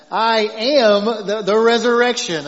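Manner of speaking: a hint of a country preacher proclaiming; country accent. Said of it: "I can't, I can't turn it off".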